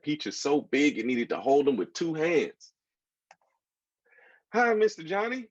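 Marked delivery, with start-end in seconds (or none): 4.5-5.5 shouted greeting. A narrator's reading. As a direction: yell it through the window. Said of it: "shouted Martine".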